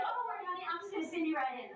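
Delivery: high-pitched front desk lady; lightly imitated "oh, honey" receptionist; friendly receptionist. Same receptionist reassures her.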